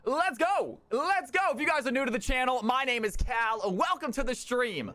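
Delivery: booming hype host intro, pitched high; grand entrance announcement. Acted. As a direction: announce the show like a ring announcer. Streamer opens the show.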